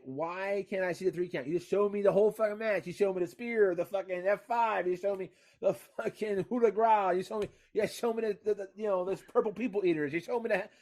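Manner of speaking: indignant fan ranting; pissed-off rant. Acted. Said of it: "My voice gets a little high when I get a little pissed off".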